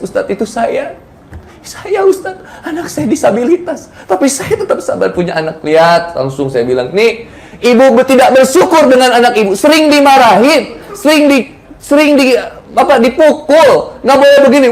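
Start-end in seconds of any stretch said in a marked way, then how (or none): 0.0-5.6 higher, softer tearful plea, put on; imitated tearful mother. Congregant mother confessing to the ustadz, imitated crying. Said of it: "sambil nangis". 6.9-14.7 higher-pitched, insistent admonishing; retold scolding. Preacher to mothers who scold their children, retold scolding.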